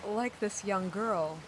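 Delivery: usual speaking voice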